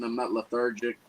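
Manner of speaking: ordinary delivery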